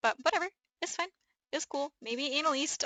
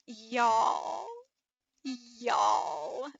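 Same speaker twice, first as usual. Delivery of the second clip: Midwest y'all. Real, not acted. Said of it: "now I'm showing where I'm from".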